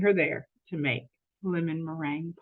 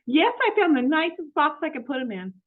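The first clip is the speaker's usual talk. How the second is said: high, bright-eyed maid voice, lightly raised; eager, innocent housekeeper talking to herself; Amelia Bedelia voice; 9 semitones higher, 4 dB louder than usual. Acted.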